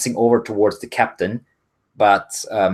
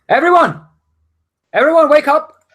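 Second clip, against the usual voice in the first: high, loud wake-up shout; urgent raised alarm call; 10 dB louder than usual. Acted. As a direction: rouse the whole crew. Deckhand calls to wake the crew.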